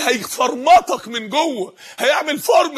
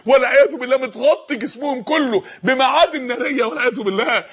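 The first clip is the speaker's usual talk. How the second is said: usual speaking voice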